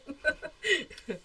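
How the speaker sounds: amused laugh that falls away. Real, not acted. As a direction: laugh out loud at the shared joke. Banter over a shared saying.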